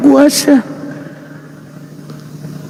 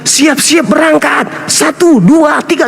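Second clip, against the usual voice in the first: shouted, higher, hyped countdown; mock launch countdown; 4 semitones higher than usual. Mocking an angel lifting Jesus to heaven, a shouted countdown.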